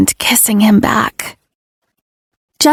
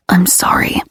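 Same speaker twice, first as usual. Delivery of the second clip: whispered apology. Acted. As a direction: murmur it like a chant. His guttural chant quoted.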